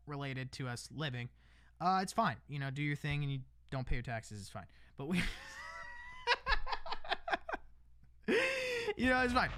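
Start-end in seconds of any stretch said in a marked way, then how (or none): 5.2-8.9 high, helpless laughing fit; burst of laughter. Real, not acted. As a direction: break into a real laugh that cracks toward a cry. Bursts out laughing mid-rant.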